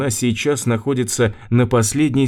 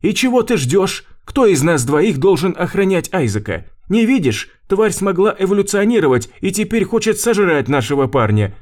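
shouting; 8 semitones higher than usual. Acted. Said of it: "крикнула на Рэй Света".

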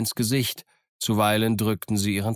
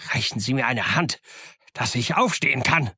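mild shout; 4 semitones higher than usual. Acted. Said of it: "und schrie".

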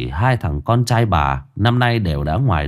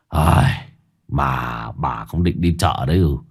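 husband's voice; 7 semitones lower than usual. A narrator's reading.

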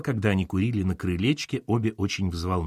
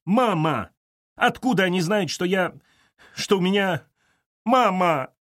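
high, agitated acted shout; 10 semitones higher, 4 dB louder than usual. A narrator's reading. Daughter demands answers from mother, shouting. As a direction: cry it out in high, broken phrases. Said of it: "закричала Катерина".

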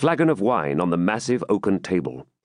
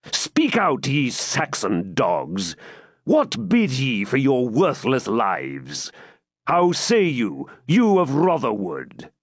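menacing Norman baron; 5 semitones higher than usual. Acted. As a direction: draw out each threat to the captives. Baron threatening his captives.

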